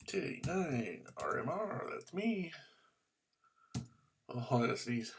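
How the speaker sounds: normal talking voice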